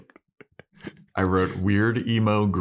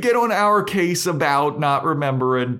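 higher, louder playful warning; mock scolding; 9 semitones higher, 5 dB louder than usual. Real, not acted. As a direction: tell off imaginary critics with raised voice. Mock warning to critical listeners.

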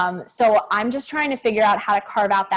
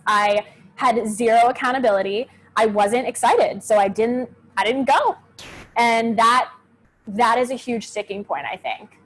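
ordinary talk

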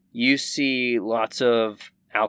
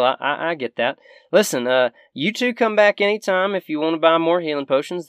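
higher folksy shopkeeper voice; grateful Southern shopkeeper; 5 semitones higher, 5 dB louder, faster than usual. Acted. Shopkeeper rewarding her rescuers, folksy and higher. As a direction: offer a reward in a higher country voice.

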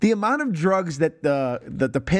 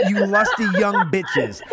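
louder, higher, laughing through it; laughing mock quote; 6 semitones higher, 4 dB louder than usual. Cohost quoting the movie line back to her, laughing.

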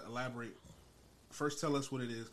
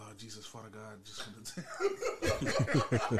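Caribbean prayer voice; 6 dB louder, much slower than usual. Retold or imitated. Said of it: "super Caribbean".

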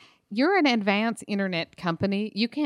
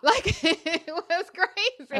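high, slow words through laughter; laughing; 11 semitones higher, 4 dB louder, slower than usual. Real, not acted. Teller recalling first chat room thrill, laughing. Laughs after reenacting the scream.

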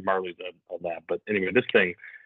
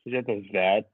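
ordinary talk, nothing marked

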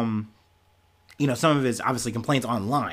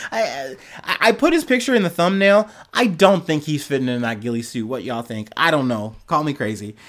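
ordinary talk

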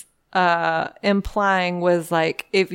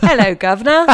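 loud, joking Cockney-style British greeting; hammed-up British accent on the edge of laughter; mock British accent; 2 semitones higher, 5 dB louder, faster than usual. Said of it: "stopped doing that accent".

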